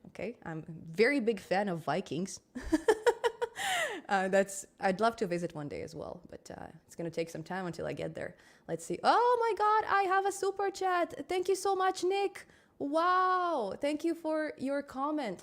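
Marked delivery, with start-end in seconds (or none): 2.4-4.0 high giggle. Real, not acted. Laughs about loving Vikings. 9.0-15.4 high, delighted thanks; thrilled gratitude for a super chat; excited. Real, not acted.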